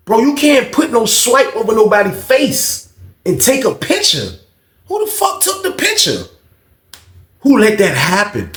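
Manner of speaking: high-pitched, outraged questioning; exasperated rant at the whole crew; indignant scolding. Acted. Scolding the gang over the picture.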